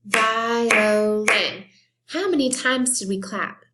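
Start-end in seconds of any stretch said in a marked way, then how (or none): 0.1-1.6 "Violin" chanted loudly as vi-o-lin; three equal, louder beats on "Violin"; broken into syllables. Shown as a demonstration. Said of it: "Let's do it again".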